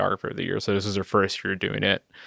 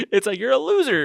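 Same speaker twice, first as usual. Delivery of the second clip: high, laughing joke; 12 semitones higher than usual. Real, not acted. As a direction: laugh through the teasing line. Joking about runner-up prizes.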